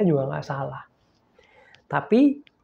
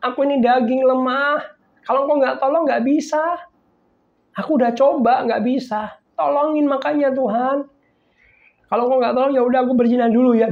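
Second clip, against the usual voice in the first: high, loud begging prayer; pleading woman; 9 semitones higher, 4 dB louder than usual. Retold or imitated. Woman begging God to help her resist, high pleading cry. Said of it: "Lalu dia bilang".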